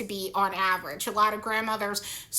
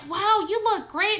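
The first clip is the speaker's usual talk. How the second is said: higher fake-sweet wow; mock compliment; 8 semitones higher than usual. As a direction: mimic her gushing. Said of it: "she's like".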